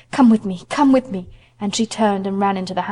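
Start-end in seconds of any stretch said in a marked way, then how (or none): 0.1-1.3 louder, hurried urging; urgent plea. Acted. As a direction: pull him along in panic. Panicked mother urging the officer.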